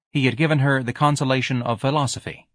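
ordinary delivery, nothing marked

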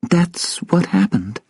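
high, slow woman's voice; faintly amused woman. Acted. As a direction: confirm it slowly with a hint of a smile. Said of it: "Sylvia almost smiled".

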